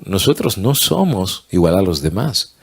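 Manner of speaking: ordinary delivery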